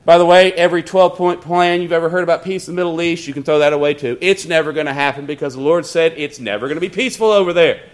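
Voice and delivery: normal talking voice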